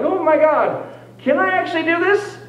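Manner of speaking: inner panic. Retold or imitated. Panicking in his head about the false leg, squeaky. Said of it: "freak out in my head".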